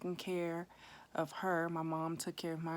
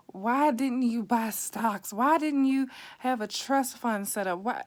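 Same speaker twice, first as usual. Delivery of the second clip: loud and higher, firing off why after why at mom; an adult child venting at her mother for never saving money, loud and pitched up; upset; 5 semitones higher, 8 dB louder than usual. Retold or imitated. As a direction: pour out the blame, questions stacked and rising. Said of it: "I was so upset with her".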